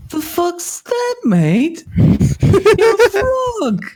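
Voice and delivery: very high, slow, laughing halfling jeer; mock the frog like a sneering halfling; high slow laughing halfling taunting the frog; rough halfling accent.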